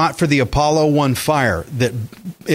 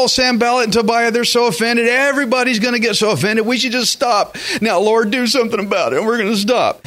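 higher-pitched, hurried mock worry; mock fretting; 5 semitones higher than usual. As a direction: imitate a fussing, easily alarmed crowd. Mocks fretful people begging to stop.

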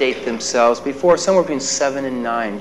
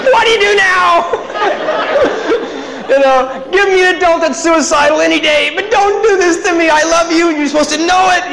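panicked inner thought; 14 semitones higher than usual. Retold or imitated. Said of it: "crying and thinking".

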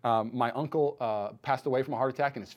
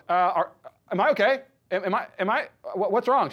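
high, loud, stumbling worried questions; acted alarm; 5 semitones higher, 5 dB louder than usual. Runner questions the technician who is freaking out, alarmed. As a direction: re-enact rising panic in halting bursts.

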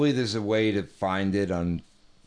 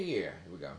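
ordinary delivery

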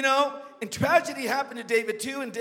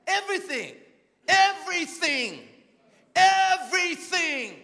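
EVERYTHING pitched high, each one slower and more drawn out; high, increasingly stretched EVERYTHING for emphasis; emphatic repetition; 7 semitones higher, 4 dB louder, slower than usual. Real, not acted. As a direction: hammer EVERYTHING, stretching it longer each time. Preacher repeats word for emphasis.

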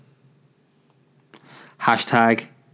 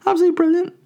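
delighted; much faster than usual. Real, not acted. Laughing reaction after the impression.

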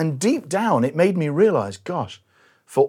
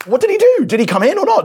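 higher and louder, burning to know; eager question; 11 semitones higher, 5 dB louder than usual. Real, not acted. Preacher asks the congregation eagerly, higher and louder. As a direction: push the question up with urgency.

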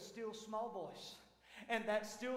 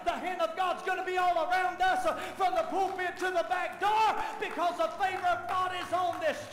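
loud, high, shouted declaration; full-force preaching; 6 semitones higher, 8 dB louder than usual. Real, not acted. Preacher declaring breakthrough over the church, full-force shouting. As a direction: preach it out to the back door.

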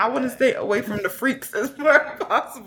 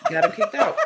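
laughing. Real, not acted. Laughing recalling the drunk.

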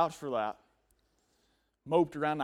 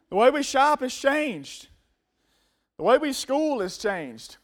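preaching cadence; 7 semitones higher, 4 dB louder, slower than usual. Acted. Preaching refrain.

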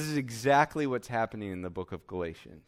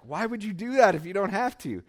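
higher-pitched, exasperated questioning; Paul upset; 7 semitones higher than usual. Acted. Apostle confronting misled believers, mad and upset. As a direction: plead in mild, acted indignation. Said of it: "that's why Paul's pretty mad and upset".

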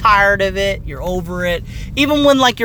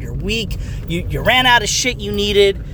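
usual speaking voice

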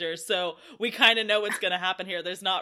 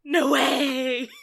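mock hiss; 4 semitones higher, 5 dB louder than usual. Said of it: "he hissed".

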